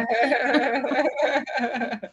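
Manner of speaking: loud, high burst of laughter; hearty laugh. Real, not acted. Listener amused by the turtle video story, laughing. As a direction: laugh out loud, long and freely.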